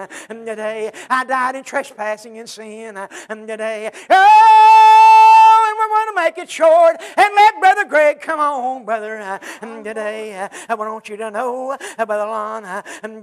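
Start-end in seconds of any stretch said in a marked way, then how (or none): 4.1-8.8 sung, high, loud and slow preacher's whoop; full-force musical whoop over the congregation; whooped preaching. Acted. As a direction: lift it into the chanted climb of a hacking preacher.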